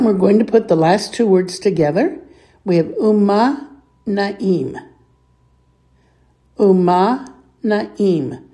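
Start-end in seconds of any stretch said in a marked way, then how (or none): 2.9-4.8 slow, separated "umma na'im" for learners; measured teacher's model of "umma na'im"; slow model pronunciation. Shown as a demonstration. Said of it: "Please repeat". 6.6-8.4 "Umma na'im" drawn out, a gap between words; slow, careful language-teacher demo; slow model pronunciation. Shown as a demonstration. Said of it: "Please repeat".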